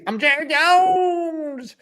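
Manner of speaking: high, drawn-out Southern howl; hammed-up impression, slow and high-pitched; Jerry Jones. Shown as a demonstration. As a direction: stretch the surname into a long high holler. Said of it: "Jerry Jones, this one's easy".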